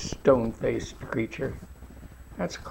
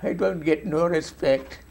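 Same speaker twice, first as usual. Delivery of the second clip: the catchphrase slower, in a hammed-up German accent; slow, slightly louder German accent take on "I don't get no respect"; mock German accent; 3 semitones higher, 4 dB louder than usual. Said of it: "living in a German accent".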